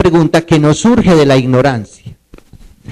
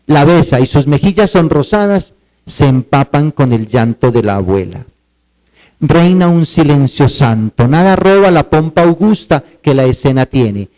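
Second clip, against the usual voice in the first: poem recital; 4 semitones lower than usual. Acted. Teacher reciting a grandmother poem to students, solemn. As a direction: read the poem as a solemn performance.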